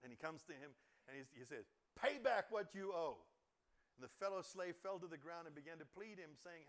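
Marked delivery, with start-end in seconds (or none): 2.0-3.2 loud, slow, higher demand; harsh demand. Acted. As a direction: hammer every word of the demand. Slave demands from fellow slave.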